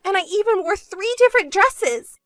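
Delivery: high-pitched whining complaint; complaining, desperate sister. Acted.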